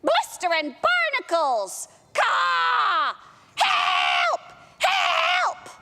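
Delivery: high squawking parrot cries for help. Acted. Parrot squawks for help while stuck at sea. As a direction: shriek it out like a panicked parrot. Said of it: "And the parrot said".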